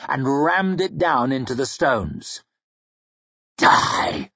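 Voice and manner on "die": hissed through clenched teeth in hate; vicious hiss. A narrator's reading. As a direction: hiss it as you kill him. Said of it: "he hissed".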